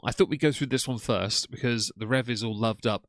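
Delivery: normal talking voice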